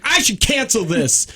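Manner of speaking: high, slow mock threat; imitated angry man. Tow driver threatening caller, mock angry. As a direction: retell his irritated threat, drawn out. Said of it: "He was all angry".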